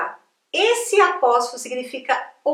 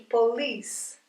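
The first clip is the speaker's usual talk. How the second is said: slow "police", stressed po-LICE; stress on second syllable; 3 semitones lower, 8 dB quieter than usual. Shown as a demonstration. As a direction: model "police" slowly for repetition, -LICE strong. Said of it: "vem antes da sílaba que é mais forte".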